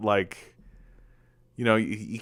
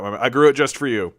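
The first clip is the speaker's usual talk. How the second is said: ordinary talk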